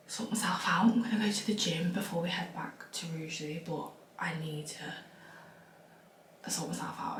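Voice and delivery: hushed early-morning whisper. Real, not acted. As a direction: whisper so nobody wakes.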